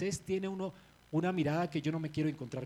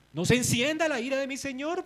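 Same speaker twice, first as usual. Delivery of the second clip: Aaron pleading; 8 semitones higher, 8 dB louder than usual. Retold or imitated. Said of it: "Noten lo que dice".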